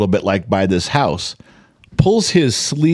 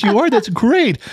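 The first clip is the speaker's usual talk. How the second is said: high, bright mock cheer; mock daughter; 12 semitones higher than usual. Mocking the daughter's cheerful reply. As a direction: imitate the daughter's clueless delight. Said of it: "She goes".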